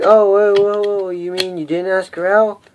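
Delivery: loud, high mock disbelief; imagined viewer's shock. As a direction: play the stunned viewer, whoa whoa. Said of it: "you're probably wondering".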